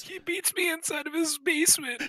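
very high squeaky plea; hammed-up high victim squeal; mock victim voice. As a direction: play a pitiful captive. Co-host joking cry for help.

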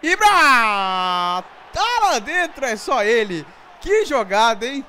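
thrilled commentary shout; excited shouting. Real, not acted. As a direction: cheer the goal loudly. Celebrating Ibra goal.

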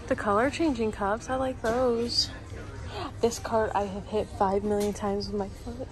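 usual speaking voice

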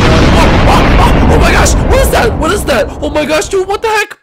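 high, loud startled outburst; panicked high-pitched shouting; alarmed yelling. Acted. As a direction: yell in shock at a sudden blast. Startled by a sudden explosion.

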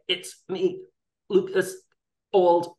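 high, far-off caller voice announcing himself; retold dad's call coming through a breaking line; imitating dad on a bad line. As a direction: play the dad faintly on a crackly phone. Dad calling over breaking line.

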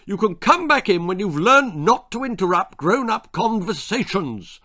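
loud, high-pitched telling-off; angry father. A narrator's reading. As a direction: scold him at full voice. Father ejects Jack angrily.

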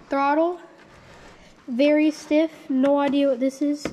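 ordinary talk

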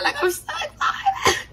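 squealing high with laughter, almost crying; excited squeal. Real, not acted. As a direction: shriek out the joy, laughing. Celebrating stage two foods.

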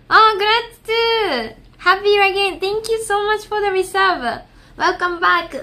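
high, bright-pitched thanks to chat; high streamer shout-out; streamer thank-you voice. Real, not acted. Thanking resub.

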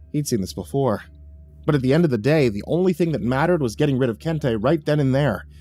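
ordinary delivery, nothing marked

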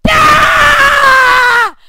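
loud raw scream of rage; furious scream. Real, not acted. Gamer screaming at a failed jump. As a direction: scream in frustration. Raging at parkour fail.

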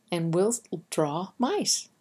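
usual speaking voice

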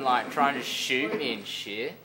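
normal talking voice

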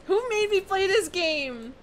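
high, drawn-out whining complaint; scared protest. Real, not acted. Scared player protests the game.

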